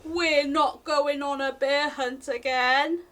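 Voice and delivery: ordinary delivery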